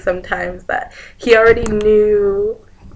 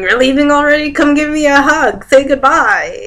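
high, loud imitation of grandpa; grandpa calling out; 8 semitones higher, 7 dB louder than usual. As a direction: call out for a goodbye hug, pitched up. Voicing grandpa calling for a hug.

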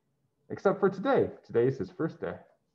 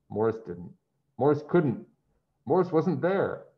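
plain reading voice

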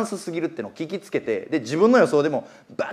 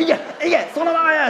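retold yelling; 9 semitones higher, faster than usual. Speaker in a shouting match with the old man, high yell. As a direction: shout the horse home. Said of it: "とかもう喧嘩やねん".

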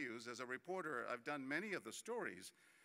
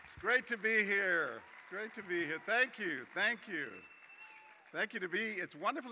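loud stage greeting; 6 semitones higher, 11 dB louder than usual. Real, not acted. Keynote speaker greets the audience on taking the stage, loud and high. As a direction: call out thanks over the room.

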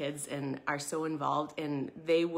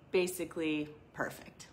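whispered aside. Real, not acted. Aside about the disciples.

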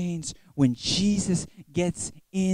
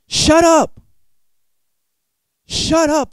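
sudden loud high shout; shouted shut up; 9 semitones higher, 10 dB louder, much slower than usual. Retold or imitated. Crowd shouting at blind beggar Bartimaeus, loud shout. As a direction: bark it out at the beggar for emphasis. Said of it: "I would never say this if, if it wasn't for emphasis".

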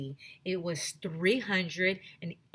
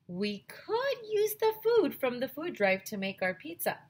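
higher, proud announcement; eager idea voice; 7 semitones higher than usual. A narrator's reading. Boy proudly pitching his pizza plan to the librarian, eager. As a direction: present it as the best idea ever.

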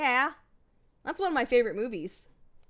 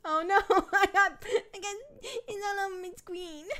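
normal talking voice

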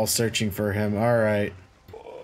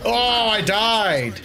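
higher, slow dismayed cry; 8 semitones higher, 3 dB louder, much slower than usual. Real, not acted. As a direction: wail that you died. Exclaiming death.

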